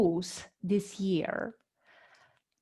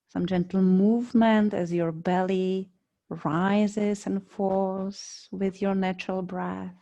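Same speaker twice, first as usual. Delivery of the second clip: guided meditation. Real, not acted. Yoga teacher guiding students lying on mats, calm meditation voice. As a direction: lead them unhurriedly into body awareness, phrase by phrase.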